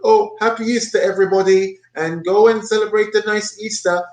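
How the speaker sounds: high mocking imitation of the narrator; sing-song mock of the narrator's cheer. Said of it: "he talking about".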